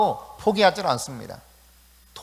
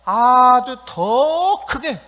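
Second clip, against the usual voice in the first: 더 stretched long, high and loud; emphatic stress; 8 semitones higher, 8 dB louder, much slower than usual. Shown as a demonstration. Preacher stressing Bartimaeus shouted even louder, drawn-out loud emphasis. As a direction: swell hard on 더 크게. Demonstrates "much louder" by volume.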